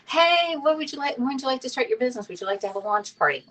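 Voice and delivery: high, fast eager sales pitch; pushy salesperson. Retold or imitated. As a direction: chase them with a sales pitch. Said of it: "so I don't come after you saying".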